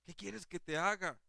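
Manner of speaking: a gentle Jesus asking kindly; preacher voicing Jesus asking Bartimeo. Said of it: "más de ternura".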